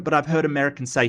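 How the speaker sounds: normal talking voice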